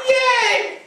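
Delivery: very high happy squeal; high excited yay; cheering. Retold or imitated. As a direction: squeal with joy. Group cheers.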